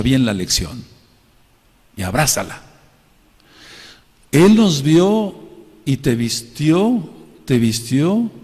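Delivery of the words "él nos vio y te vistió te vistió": high, loud, slow proclamation; climactic preaching. Real, not acted. Pastor proclaiming God's welcome, slow soaring preaching. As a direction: lift it up like the climax.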